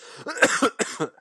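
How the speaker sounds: high, strained coughing fit; choking cough. Real, not acted. Host nearly choking on cola, hacking out a strained cough. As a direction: hack it out like you nearly choked. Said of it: "I nearly choked".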